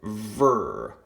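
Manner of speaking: "ver" drawn out by itself; second syllable alone. Shown as a demonstration. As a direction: sound out "ver" as its own beat. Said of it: "two syllables".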